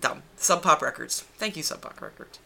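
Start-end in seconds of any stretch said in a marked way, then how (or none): 1.4-2.4 sarcastic thanks. Real, not acted. Annoyed about delayed download code.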